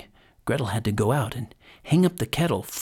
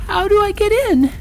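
put-on very high child voice, rising in volume; Gretel, little girl; 4 dB louder than usual. A narrator's reading. Gretel outwits the witch by playing clueless, childlike. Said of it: "But Gretel perceived her intention and said".

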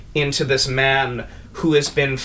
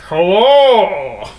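higher and louder, hammed-up demon hello; demon greeting; 6 semitones higher, 8 dB louder than usual. Demon turning to the party, higher loud greeting. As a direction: greet them like a gleeful monster.

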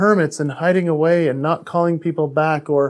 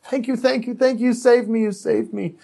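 high, squeaky thank-yous from a rescued dog; squealing grateful dog; 6 semitones higher than usual. Retold or imitated. Rescued dog licking its rescuer, high grateful squeals. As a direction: gush it like a puppy that was just saved. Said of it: "barking and squealing like".

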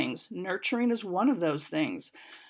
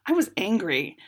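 normal talking voice

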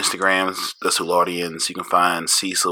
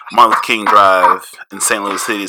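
ordinary talk

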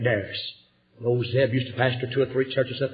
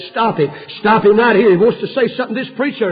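urgent family plea; 6 semitones higher, 6 dB louder than usual. Retold or imitated. Family begging at the hospital.

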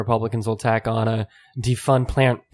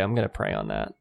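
soft low pious brush-off; pious dodge; 5 semitones lower, 6 dB quieter than usual. Retold or imitated. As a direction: murmur it like a polite refusal. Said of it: "just say".

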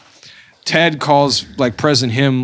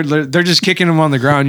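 ordinary talk, nothing marked

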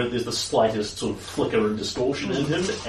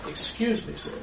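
polite devil; 10 semitones higher, 5 dB quieter than usual. Acted. Devil addresses player politely.

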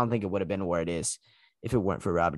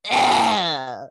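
buzzer groan; 8 semitones higher, 7 dB louder than usual. Real, not acted. Buzzer noise at friend's pick.

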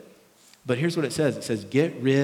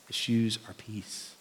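"The shoes are peace." dropped soft and slow; softer, slower repeat; 6 dB quieter, slower than usual. Shown as a demonstration. Pastor repeating the point to his church, soft and slow. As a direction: let "peace" land quietly and slowly.

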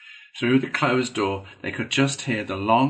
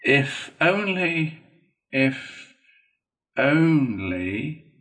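slow, drawn-out doubt; high, lingering scepticism; dubious; 5 semitones higher, 4 dB louder, much slower than usual. A narrator's reading. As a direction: stretch each "only" sceptically.

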